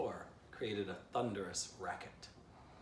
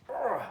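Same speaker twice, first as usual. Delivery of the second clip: disgusted groan; 14 semitones higher, 11 dB louder than usual. A narrator's reading. Squirrel twitched tail at him.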